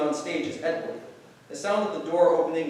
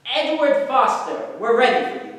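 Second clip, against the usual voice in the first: called from offstage; 7 semitones higher, 6 dB louder than usual. Acted. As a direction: call him in from behind the door. Offstage caller summons Edward.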